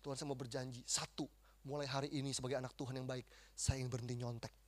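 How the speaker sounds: soft, low vow to God; earnest prayer. Retold or imitated. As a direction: reenact a hushed, solemn promise in prayer. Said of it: "ya doa".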